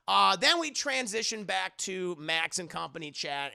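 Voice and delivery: ordinary talk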